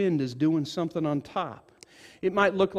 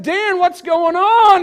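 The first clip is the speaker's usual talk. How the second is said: loud, high, slow call; 13 semitones higher, 11 dB louder, slower than usual. Retold or imitated. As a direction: draw it out, calling down to Dan. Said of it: "And I said".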